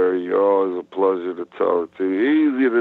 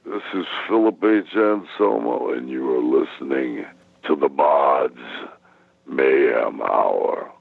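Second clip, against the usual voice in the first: wrestling promo; 9 semitones lower than usual. Shown as a demonstration. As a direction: growl the show name low and stretch the end. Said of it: "would you care to do a promo".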